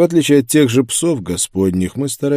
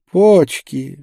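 higher, louder dreamy preacher; 6 semitones higher, 5 dB louder than usual. A narrator's reading. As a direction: savour it like a daydream, pitched up. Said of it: "сказал проповедник мечтательно". (мечтательно)

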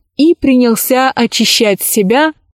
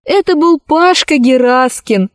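plain reading voice

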